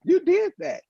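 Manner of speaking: high, excited fatherly praise; father praising his son for the tire, high and proud; proud dad. Retold or imitated. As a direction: rise up in delighted pride.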